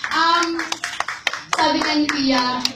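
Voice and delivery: ordinary talk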